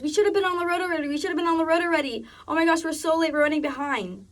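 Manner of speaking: imitating a frantic, rushing boss; freaking out. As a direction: rattle it off like a boss in a panic. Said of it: "starts freaking out".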